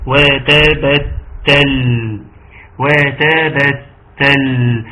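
very slow وتبتل with every fatha given its full sound; clear fathas. Shown as a demonstration. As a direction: draw out وتبتل, open each fatha and hold the ل. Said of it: "مراعاة الفتحات". (تلاوة بطيئة مع مراعاة الفتحات في «وتبتل»)

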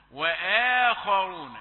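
وآخرون slow, hamza between light and heavy; correct recitation. Shown as a demonstration. Teacher corrects student, slow model recitation. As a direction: draw out وآخرون evenly. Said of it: "بين الترقيق والتفخيم".

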